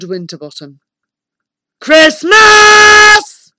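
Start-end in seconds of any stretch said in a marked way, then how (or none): 1.8-3.5 huge high shouted greeting; booming giant. A narrator's reading. Giant wizard greets Christmas at his castle, booming. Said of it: "he boomed in a voice that made my head spin".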